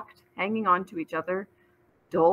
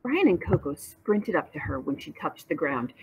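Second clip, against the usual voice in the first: plain reading voice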